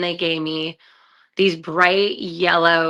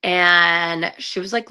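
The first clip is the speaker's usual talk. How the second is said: normal talking voice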